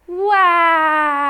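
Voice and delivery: long high mock wail; very loud baby cry. A narrator's reading. Baby who just fell while climbing, crying very loudly. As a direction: bawl one long "wah" like a baby. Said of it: "Baby just cried very loudly".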